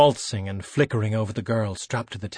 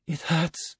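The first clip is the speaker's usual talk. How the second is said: put-on pained young woman; 6 semitones higher, 5 dB quieter than usual. Girl strapped to table, in pain.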